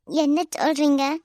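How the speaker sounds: loud, startled cry of her name; a sudden shocked call to Vinitha; shocked outburst. Acted. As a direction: blurt it out in alarm.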